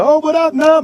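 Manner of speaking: high, excited shout-out; hyped-up welcome; hyped greeting. Retold or imitated. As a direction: greet him like an old friend, way up high. DJ greets him in the studio.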